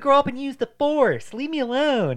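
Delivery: high, loud mock whine; whiny kid. Joking about the bullied kid, a loud high whine. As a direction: whine like a picked-on boy. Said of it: "he's like".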